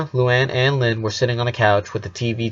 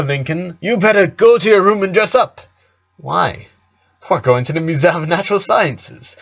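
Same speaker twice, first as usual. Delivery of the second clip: higher, bubbly dad pushing a plan; cheery dad; 6 semitones higher, 3 dB louder than usual. Acted. Dad urging Lincoln to dress for the museum, higher and upbeat. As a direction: sell the trip with big enthusiasm.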